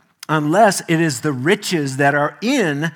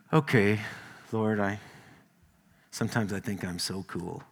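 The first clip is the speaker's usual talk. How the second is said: sheepish; 8 semitones lower, 10 dB quieter than usual. Retold or imitated. Sheepish prayer retold.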